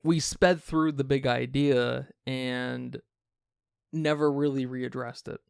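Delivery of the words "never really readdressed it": usual speaking voice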